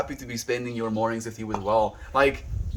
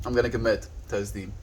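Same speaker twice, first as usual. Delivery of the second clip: normal talking voice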